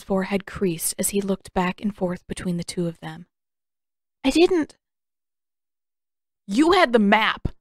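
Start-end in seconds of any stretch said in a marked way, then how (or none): 4.2-4.8 panicked denial. Acted. Aang caught lying, panicked denial. 6.5-7.5 angry accusation. Acted. Sokka accuses Aang.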